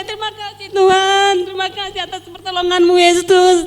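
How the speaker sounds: loud thankful prayer. Retold or imitated. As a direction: pour it out loud, overjoyed. Hugging husband, thanking God aloud. (doa syukur yang lantang)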